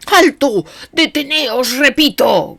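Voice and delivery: high, loud frantic cry; frantic shout. A narrator's reading. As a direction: yell to stop them, frantic. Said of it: "gritaba Gregory frenético". (grito frenético)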